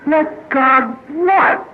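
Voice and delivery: high, drawn-out bewilderment; slow, high-pitched puzzled question; baffled thought. Acted. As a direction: stretch it out, high and utterly lost. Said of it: "he sits there for the next fifty-eight minutes thinking".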